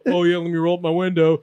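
hammed-up sarcastic quip. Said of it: "I totally said it like that".

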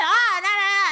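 high, very loud mock protest; mimicked yelling. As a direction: drown them out, imitating a stranger. Imitating stranger yelling back.

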